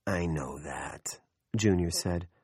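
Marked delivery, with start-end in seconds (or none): 0.1-1.2 low, slow near-whisper; strained patience. A narrator's reading. Junior resisting the urge to choke them, quietly patient. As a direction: hold back, very soft and slow. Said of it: "with admirable patience for one who was thinking".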